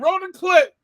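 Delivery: high, loud acted order; mock urgent call. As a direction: shout it up to an imagined crew. Acted call to promotion team.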